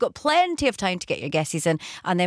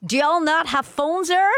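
Virginian accent; 7 semitones higher than usual. Shown as a demonstration. Radio presenter asked for their best accent, Virginian accent. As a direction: do your best Virginian accent, rising at the end. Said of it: "What's your best Virginian accent?".

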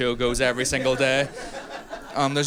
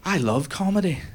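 high-pitched, delighted doctor character; doctor gushing in a raised voice; excited Thai doctor; 5 semitones higher than usual. Acted. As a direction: play a comedy-loving doctor, voice lifted high. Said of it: "And he goes".

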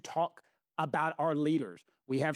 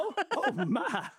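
high, hammed-up Southern "oh, my" with a laugh; mock Southern oh my; 8 semitones higher than usual. Teasing her co-host with a high mock Southern gasp. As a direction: play the drawn-out Southern belle, laughing. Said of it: "That's when your Southern accent should come in".

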